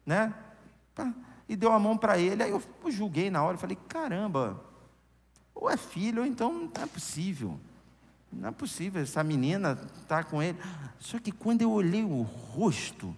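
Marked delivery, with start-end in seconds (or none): 3.9-10.6 softly voiced private disbelief; espanto contido. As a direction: mutter it to yourself, mildly scandalized. Retells his shocked private judgment. (espanto em voz baixa)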